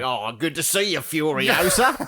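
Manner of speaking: high, hammed-up Aussie greeting; broad Aussie accent. Scavengers greeting Furiosa, hammed-up Aussie accent. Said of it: "And they're all Aussie".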